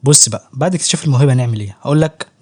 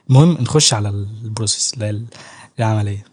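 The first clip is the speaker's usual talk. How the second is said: ordinary delivery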